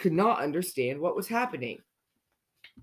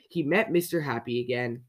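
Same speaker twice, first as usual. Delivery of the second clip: usual speaking voice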